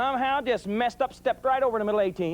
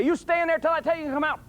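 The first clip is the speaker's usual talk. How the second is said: angry parental order; 8 semitones higher than usual. Retold or imitated.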